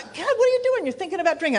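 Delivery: high-pitched mock alarm; alarmed self-scolding. She catches herself reading the billboards, alarmed scolding.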